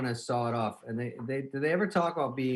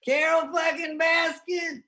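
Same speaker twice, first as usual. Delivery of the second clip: high, slow, drawn-out yell; excited shout; 4 dB louder, much slower than usual. Real, not acted. Host shouts the Tiger King name, thrilled and loud. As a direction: belt out the name like a fan.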